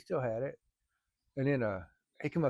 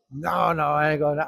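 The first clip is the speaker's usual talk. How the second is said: friend's louder refusal; 3 semitones higher, 9 dB louder than usual. Retold or imitated. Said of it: "friend refuses".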